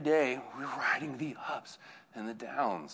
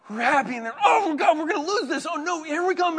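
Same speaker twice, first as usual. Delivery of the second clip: very high, loud, laughing mock dread; laughing panic; 8 dB louder than usual. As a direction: squeal in fake fear through laughter. Family reliving game, laughing.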